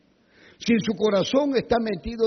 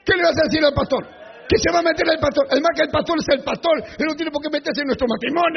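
angry husband shouting; 7 semitones higher, much faster than usual. Retold or imitated. Pastor imitating husband yelling at wife, fast. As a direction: bark it at your wife. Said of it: "la esposa le dice".

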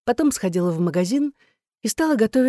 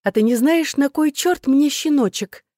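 higher, emphatic, sugar-coated challenge; mock affectionate; 8 semitones higher, 3 dB louder than usual. A narrator's reading. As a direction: sweetly demand an explanation. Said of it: "ласково сказала я". (с притворной лаской, с нажимом)

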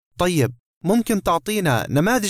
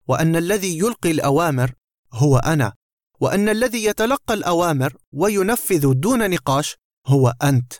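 ordinary delivery, nothing marked

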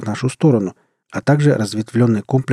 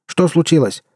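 frightened shout; 6 semitones higher than usual. A narrator's reading.

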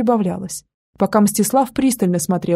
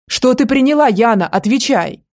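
higher, louder demand, rising on приняла; demanding shout; 3 semitones higher, 4 dB louder than usual. Acted. Man shaking the girl again for the answer, loud demanding shout. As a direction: bark the question at her.

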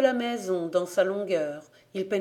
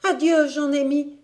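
urgent farewell; 7 semitones higher, 6 dB louder than usual. A narrator's reading. Hurried farewell while running, alarmed.

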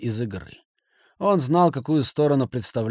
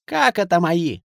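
high, loud outraged yell; 6 semitones higher, 5 dB louder than usual. A narrator's reading. Kasimov yells back at the banker, loud and high. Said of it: "заорал Касимов".

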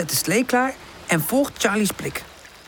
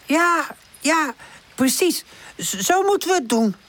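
high, hesitant stammer; high-pitched, unsure character voice; 9 semitones higher than usual. A narrator's reading. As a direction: stumble into agreeing, in short bursts. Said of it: "stamelt Woezel".

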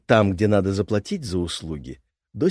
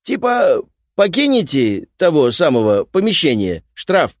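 gruff repairman; 8 semitones higher, 8 dB louder than usual. Acted. Repairman threatens fine.

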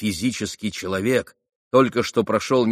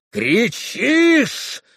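high-pitched enraged cry; angry yell; 13 semitones higher, 4 dB louder than usual. A narrator's reading. Mitya bursting in on screaming Fenya, angry yell. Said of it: "завопил Митя".

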